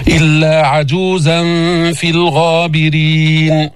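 slow chanted recitation, clear lam; ترتيل بطيء; Quran recitation. Shown as a demonstration. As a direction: chant slowly, sounding the lam. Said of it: "كمان هون الغابرين، تلفظنا".

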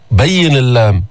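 ordinary talk

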